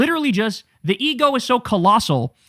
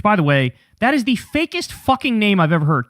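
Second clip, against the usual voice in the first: usual speaking voice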